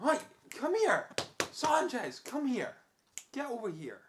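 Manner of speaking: high call to the dog. Real, not acted. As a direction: call her over firmly. Calling dog over.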